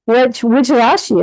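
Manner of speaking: a keen mentor pressing for details, slowing on the last question; curious teacher. Retold or imitated. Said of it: "He goes".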